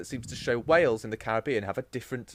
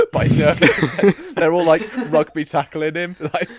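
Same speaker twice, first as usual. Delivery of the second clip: loud laugh breaking through words; 5 semitones higher, 12 dB louder than usual. Real, not acted.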